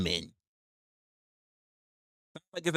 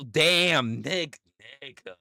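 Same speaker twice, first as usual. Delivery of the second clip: ordinary talk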